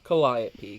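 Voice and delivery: Calliope as kuh-LIE-uh-pee; Calliope, second syllable stressed like "lie". Shown as a demonstration. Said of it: "back to".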